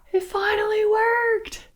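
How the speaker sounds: high, hushed squeal of wonder; thrilled amazement. Pretend. Storyteller reacting to Beauty waking, amazed high squeal. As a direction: marvel at it in a high voice. Gasping as the maiden wakes.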